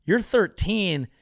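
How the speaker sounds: usual speaking voice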